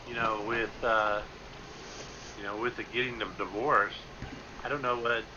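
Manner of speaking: ordinary talk, nothing marked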